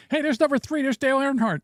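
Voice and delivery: high-pitched gleeful call-out; playful high exclamation; mock excited spotting. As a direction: point it out like a race fan. Acting out spotting numbered cockroaches.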